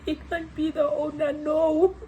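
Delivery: high, half-crying incredulous voice; high-pitched retold disbelief; mock disbelief. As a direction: wail it in comic denial. Acting out disbelief at owner.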